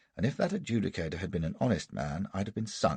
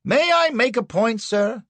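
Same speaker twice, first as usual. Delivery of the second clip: much higher, louder cut-in; Anderson interrupting; 8 dB louder than usual. Acted. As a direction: leap in over the other lawyer. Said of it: "says Anderson".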